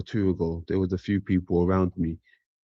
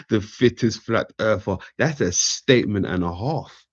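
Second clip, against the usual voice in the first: ordinary talk, nothing marked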